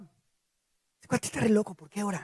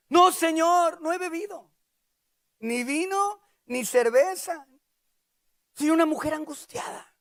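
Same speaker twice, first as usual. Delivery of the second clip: high-pitched, earnest protest; anguished denial; 8 semitones higher, slower than usual. Acted. Ana accused of drunkenness, high anguished denial. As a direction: plead innocence to an elder.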